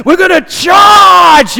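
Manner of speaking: high, loud, drawn-out shout; rally the army with a roar; battle cry. Retold or imitated. Preacher mimicking a general rallying his army, shouting. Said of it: "general to stand in front of his army".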